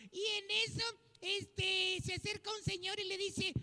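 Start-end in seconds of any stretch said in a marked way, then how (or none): none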